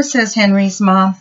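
ordinary delivery

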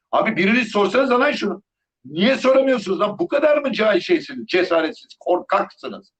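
exasperated scolding. Real, not acted. Vlogger berating cowardly journalists, exasperated high-pitched scolding. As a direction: berate them for not asking.

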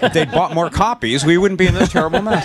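high, fast, laughing joke; giggly high-pitched quip. Real, not acted. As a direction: laugh the words out at your own joke. Joking about book sales, laughing.

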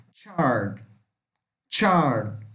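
"chard" opening on a tch sound, final D barely heard; English pronunciation demo. Shown as a demonstration. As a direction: draw out "chard" with a falling tone. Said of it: "vamos falar com som de T com X junto".

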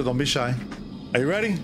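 ordinary delivery, nothing marked

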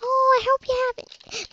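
high-pitched mock parent voice; gushing parent. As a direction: imitate a cooing parent, high and syrupy. Repeats the mocking parent impression.

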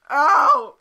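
loud yelp dissolving into laughter; laugh-cry through real pain. Real, not acted. Sour candy pain, laughing and crying.

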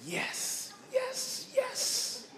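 squeaky, very high overjoyed yeses; excited yes. Retold or imitated. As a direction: shoot way up in pitch with joy.

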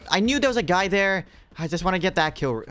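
loud eager hunting call; excited. Real, not acted. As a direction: pounce on it with excitement. Spots enemy, excited.

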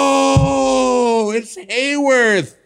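high, loud crying shout, slow on Hayworth; anguished cry. Retold or imitated. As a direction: wail the correction in despair. Discovering the wrong address.

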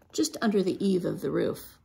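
ordinary delivery

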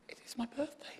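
hushed unvoiced whisper. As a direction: breathe it to yourself.

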